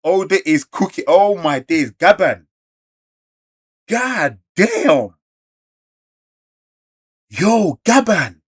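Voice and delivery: loud, high excited yelling; hyped. Real, not acted. Cheering Gaban's arrival for the viewers, hyped shouting. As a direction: hype it up like the best chapter ever.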